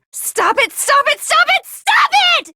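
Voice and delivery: rising to a high scream; building scream. Acted. Junko screaming at the students to stop.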